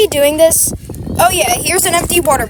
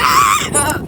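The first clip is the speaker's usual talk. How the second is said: very high, short shriek; piercing little scream of alarm; startled scream. Pretend. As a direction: let out a sudden high yelp. Yelp while in the water.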